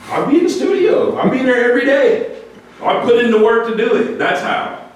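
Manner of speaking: high, half-laughing declaration; proud insistence. Real, not acted. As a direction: proclaim it proudly. Proudly insisting on his hard work.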